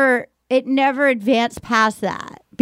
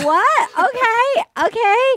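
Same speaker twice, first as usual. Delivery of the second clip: higher, baffled "what, okay"; a caught-off-guard okay; surprised; 10 semitones higher, much slower than usual. Retold or imitated. Stunned reaction to a saying.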